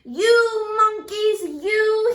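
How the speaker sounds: high, loud, slow singsong scold; scolding the monkeys. Acted. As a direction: wag a finger with your voice. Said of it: "he said, shaking a finger at them".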